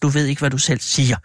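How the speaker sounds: plain reading voice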